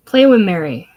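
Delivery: put-on loud little-girl plea to play; child pleading. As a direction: call out like a small child begging.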